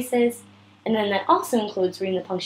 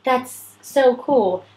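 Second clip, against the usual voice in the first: drawn-out, higher and louder in awe; excited; 4 semitones higher, 5 dB louder, much slower than usual. Shown as a demonstration. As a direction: stretch it with wonder, very slowly. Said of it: "Or if you kind of know, like".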